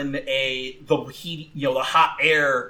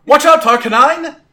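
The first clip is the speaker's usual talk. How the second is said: high, loud warning shout; calling out; 12 semitones higher, 10 dB louder than usual. Acted. Trainer warns Arcanine of lightning, calling out high. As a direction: yell a warning to your fighter. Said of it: "Gwen called out".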